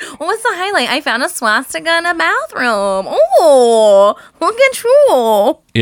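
high-pitched, hammed-up gushing; mock cheerful exchange. Friend spoofing adult small talk about a swastika, mock gushing. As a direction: coo it in a high, mocking singsong, stretching the last words.

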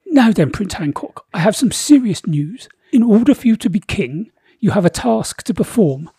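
booming royal decree; pompous king voice. Acted. Narrator voices King Boris.

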